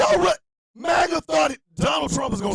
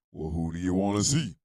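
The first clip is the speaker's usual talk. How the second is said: much lower, heavy male voice; deep voice guy; 4 dB quieter than usual. Retold or imitated. Band member answering the door, deep man's voice. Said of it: "this deep voice guy going".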